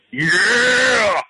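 high, loud drawn-out whoop; yelling fan. Shown as a demonstration. Caller admitting he's the guy at shows, yelling. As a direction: yell it long like a rowdy concertgoer. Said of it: "I'm the guy who's yelling".

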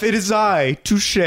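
dramatic reveal. Retold or imitated. Magician unmasking, voiced.

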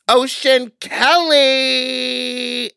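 stretched high shout of name; excited hype call. Acted. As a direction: announce the name like a hype host. Hyping lineup names.

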